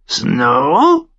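sehr hoher, lauter Vogelschrei; krächz es wie ein Rabe; krächzender Rabe. A narrator's reading. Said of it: "krächzte der Rabe". (sehr hoch und laut gekrächzt)

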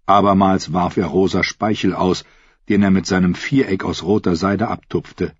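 ordinary delivery

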